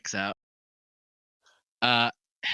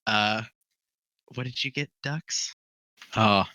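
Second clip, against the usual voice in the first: ordinary talk, nothing marked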